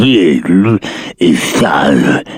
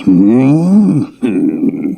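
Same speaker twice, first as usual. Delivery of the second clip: ordinary talk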